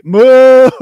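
long, loud, high cow moo. Shown as a demonstration. Showing how they will heckle, loud drawn-out moo. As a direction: moo like a cow at full volume. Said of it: "we're just gonna moo you".

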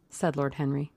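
ordinary delivery

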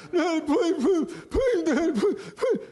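put-on high whiny kid's plea; high, pleading child voice, hammed up; begging child. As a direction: beg like a little boy wanting candy.

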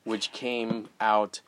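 ordinary talk

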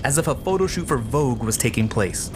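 ordinary delivery, nothing marked

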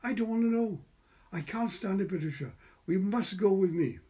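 high, loud distraught plea; begging not to go alone; near tears. A narrator's reading.

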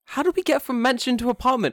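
high, loud incredulous question; baffled outburst. Retold or imitated. Jordan reacts to her explanation, loud mock bafflement. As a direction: exclaim in exaggerated disbelief. Baffled retort in retold quarrel.

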